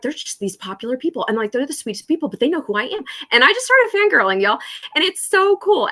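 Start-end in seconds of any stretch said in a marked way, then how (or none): 3.3-5.9 high, loud gushing squeal of excitement; fangirling. Real, not acted. Telling viewers about her fangirling, excited squeal. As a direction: gush like a starstruck fan.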